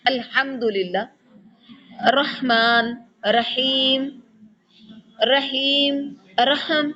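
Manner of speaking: الحمدللہ رحمان رحیم with a breathy throat ح; clear ح. Shown as a demonstration. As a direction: stress the ح in رحیم. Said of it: "جن کے اندر ہا ہے". (ح کو حلق سے واضح)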